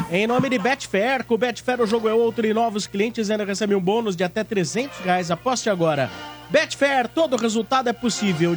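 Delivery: smooth sponsor read over music. Acted. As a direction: sell it like a radio ad. Reads sponsor ad.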